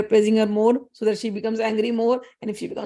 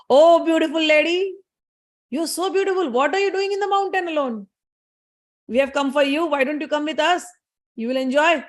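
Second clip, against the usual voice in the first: high, sing-song wooing; leering demon; 5 semitones higher than usual. Acted. Demon Chandamund wooing the beautiful goddess, high and leering. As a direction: sweet-talk her like a leering demon.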